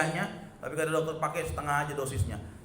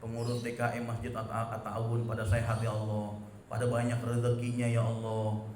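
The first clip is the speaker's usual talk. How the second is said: quiet prayer; 4 semitones lower than usual. Shown as a demonstration. Preacher softly voices the congregation's prayer for mosque staff. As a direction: pray it gently, as if whispering to God. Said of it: "Bapak doain".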